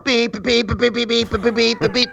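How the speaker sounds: rhythmic spoken beeps like a horn; mild mock horn beeping; imitated car horn. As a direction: tap out the horn with your voice. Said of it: "starts beeping the horn".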